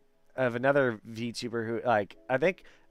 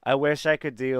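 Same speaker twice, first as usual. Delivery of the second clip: normal talking voice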